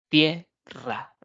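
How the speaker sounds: «tierra» split in two, tie - rra, with a pause; sílaba por sílaba. Shown as a demonstration. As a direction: give «tierra» two detached syllables. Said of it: "aquellas formadas por dos sílabas". («tierra» separado: tie - rra)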